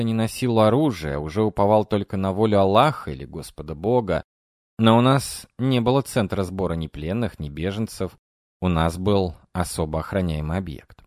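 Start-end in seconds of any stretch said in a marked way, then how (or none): none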